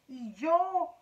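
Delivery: slow, high, loud spider; drawn-out indignant question. A narrator's reading. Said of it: "dijo la araña".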